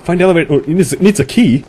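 loud, high-pitched urgent exclaiming; frantic and loud; urgent panic. Real, not acted. Fleeing, looking for a way out.

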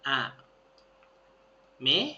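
アメ split into two slow syllables, อา then เมะ; spelled out slowly. Shown as a demonstration. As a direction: sound it out syllable by syllable. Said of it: "syllable-by-syllable pronunciation".

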